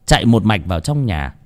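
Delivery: plain reading voice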